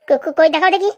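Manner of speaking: stuttering challenge; flustered false starts; nervous stammer. Acted. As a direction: stumble on the first syllable, trying to stand up to them. Stammers when threatened with evidence.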